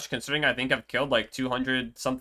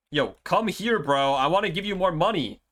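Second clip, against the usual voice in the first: calling out; 7 semitones higher, 4 dB louder than usual. Real, not acted. Calling out to villager.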